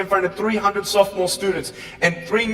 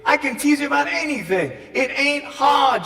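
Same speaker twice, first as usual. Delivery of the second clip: ordinary talk, nothing marked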